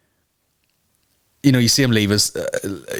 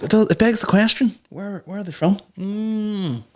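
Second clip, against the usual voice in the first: mock innocent question; 10 semitones higher than usual. To viewers wondering about killers' origins, a coy high question. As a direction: ask it all sweet and coy.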